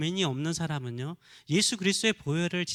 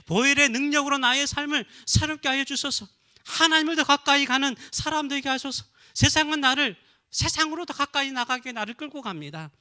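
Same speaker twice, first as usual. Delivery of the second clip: loud, high fervent prayer; preacher leading a loud fervent plea to God; crying out in prayer; 6 semitones higher, 5 dB louder than usual. Shown as a demonstration. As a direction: plead with God at full voice. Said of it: "울면서도 부르짖어야 돼요".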